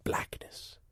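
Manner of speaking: breathy whispered blackness, like passing out; whispered dramatic pause. Acted. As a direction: let the word fade into a whisper. Dramatic story climax.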